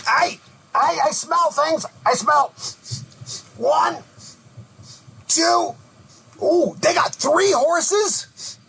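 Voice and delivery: high, excited little goblin sniffing out loot. Acted. Goblin on the ogre's back calling out to the travelers, high and greedy. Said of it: "the little goblin, he waves and he goes".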